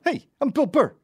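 high, loud bad comedian impression; Bill Burr. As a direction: ham up a bad impression of a brash comic. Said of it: "Bill Burr has a bit".

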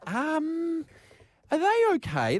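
high, hesitant nervous question; sheepish high voice. Retold or imitated. To the waitress, asking nervously about the plates. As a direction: squeak it out guiltily. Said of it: "and I went".

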